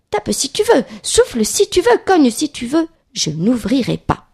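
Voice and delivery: very high sing-song taunts, dropping to a firm refusal; defiant little pig. Acted. Little pig dares the wolf from the brick house, defiant. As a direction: dare the wolf in a high piglet voice.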